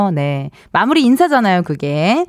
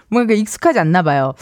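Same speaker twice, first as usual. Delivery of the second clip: ordinary delivery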